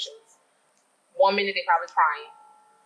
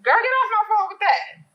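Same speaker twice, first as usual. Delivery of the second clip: high, loud mock dismissal of a caller; mock fed-up; 12 semitones higher, 4 dB louder than usual. Planner imagines brushing off an anxious bride on the phone, mock fed-up. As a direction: shoot it out high and sassy, cutting the caller off. Acting out what she'd like to say.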